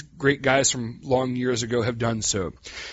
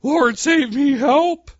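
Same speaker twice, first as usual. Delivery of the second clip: much higher, louder desperate cry for help; panicked cry; 12 semitones higher, 7 dB louder, much slower than usual. Retold or imitated. Preacher acting out Peter crying out while sinking. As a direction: shout it like you're going under.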